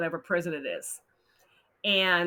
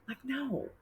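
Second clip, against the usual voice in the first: soft, higher dismissive no; flat refusal; 5 semitones higher, 10 dB quieter than usual. Real, not acted. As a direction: brush it off, quietly.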